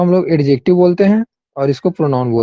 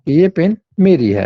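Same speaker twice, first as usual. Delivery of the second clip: slow dictation; much slower than usual. Shown as a demonstration. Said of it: "ऐसे बोल रहे हैं".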